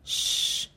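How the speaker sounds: "sh" as a long unvoiced hiss through rounded lips; round-lipped SH. Shown as a demonstration. Teacher introducing the SH sound to learners, round-lipped "sh". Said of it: "âm S H tròn miệng".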